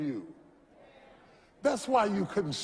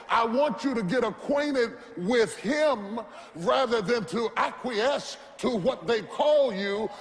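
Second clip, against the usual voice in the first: rising sermon climax, shouting over the music; fired-up revival preaching; preaching at full force; 6 semitones higher than usual. Acted. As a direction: preach it with everything you have.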